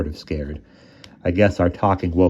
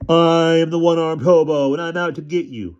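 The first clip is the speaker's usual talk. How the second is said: imitated hobo voice; 10 semitones higher, 4 dB louder than usual. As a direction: ham up the monster voice to tease. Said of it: "I imitated how he sounded".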